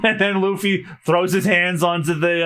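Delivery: ordinary delivery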